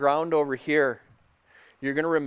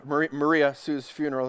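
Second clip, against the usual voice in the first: ordinary delivery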